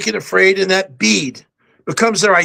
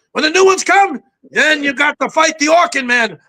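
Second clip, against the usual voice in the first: higher pitched cartoon roach general with a hint of a Cuban accent; 5 semitones higher than usual. Auditioning General Kakamoto for the movie director, high cartoon voice with a light Cuban accent. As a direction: play a proud, desperate roach commander rallying his troops. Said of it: "I wanted to make him a little bit like a Cuban".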